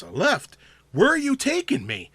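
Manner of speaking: mock indignation, climbing high; imitating confused driver. Driver questioning his GPS in an ad, mock bewilderment. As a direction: question it with rising, hammed-up bewilderment. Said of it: "He goes".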